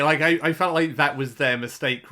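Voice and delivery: normal talking voice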